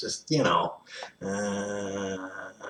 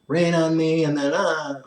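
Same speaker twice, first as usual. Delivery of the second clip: Jim Carrey quote; 9 semitones higher, 7 dB louder than usual. Retold or imitated. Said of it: "it was Jim Carrey".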